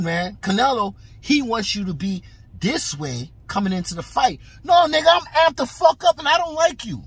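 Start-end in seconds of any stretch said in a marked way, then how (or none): 4.6-7.0 amped-up shout. Retold or imitated. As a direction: yell it like you mean the beef. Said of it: "This is what you gotta say".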